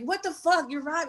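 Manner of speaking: imitating mad brother. Brother in the car freaking out, mock angry and high. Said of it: "he was so mad".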